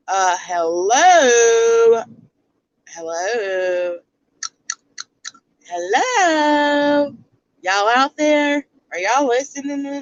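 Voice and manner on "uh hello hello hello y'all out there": slow, drawn-out mock call into the void; sarcastic hello. Vlogger mockingly calling out to her viewers, drawn-out sarcastic hello. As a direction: call out as if nobody is listening, louder.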